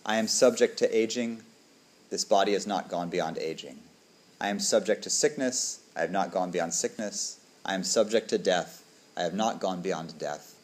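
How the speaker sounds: measured, quiet chant-like reading; reciting the five recollections, measured and solemn; liturgical recitation. Acted.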